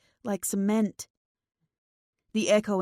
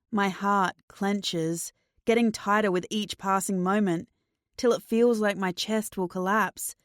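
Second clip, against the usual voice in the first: ordinary delivery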